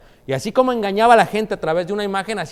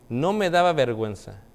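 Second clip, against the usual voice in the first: normal talking voice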